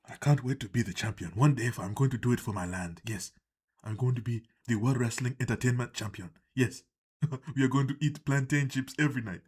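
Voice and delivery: low mock African accent; deliberate African accent, making grand promises. Wrestler promising to be champion, in a low mock African accent. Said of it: "you just have an African voice just randomly".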